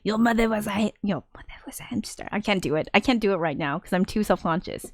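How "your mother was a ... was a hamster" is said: put-on half-hearted French accent, fading to hushed; shy stab at a French accent; attempted French accent. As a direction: try a mock French accent, self-consciously trailing off. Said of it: "I'm gonna try to do the accent".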